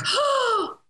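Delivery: sharp, very high gasp of shock; startled gasp. Retold or imitated. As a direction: gasp as if the room just froze. People in room reacting, gasping.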